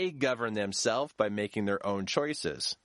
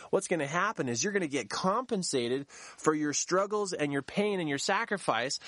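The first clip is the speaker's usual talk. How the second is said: normal talking voice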